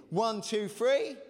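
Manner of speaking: rally the crowd into it; countdown building to a shout. Real, not acted. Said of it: "say hypocrites like you mean it".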